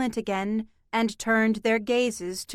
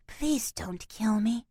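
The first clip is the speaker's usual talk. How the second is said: slow, softer eerie whispered echo; a creature breathing the words back at her; cat mimicking her plea in a slow whisper; wispy scratchy whisper; 5 dB quieter than usual. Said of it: "in a wispy, scratchy voice".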